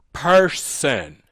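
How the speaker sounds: person stretched into two beats; stress on person. Shown as a demonstration. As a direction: set person apart with weight. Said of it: "the reason I emphasize the word".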